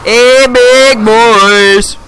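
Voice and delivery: very slow, stretched-out tease; drawn-out hey big boys. Pretend. Teasing a friend on voice chat, very slowly. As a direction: drag every word out as a joke.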